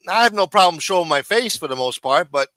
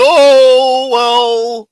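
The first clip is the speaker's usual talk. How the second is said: high, loud mocking "oh, well"; mock high exclaim; 7 semitones higher, 10 dB louder than usual. Reading a chatter's reply aloud, mock high exclaim. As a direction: toss it off in a shrill mock voice. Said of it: "He said".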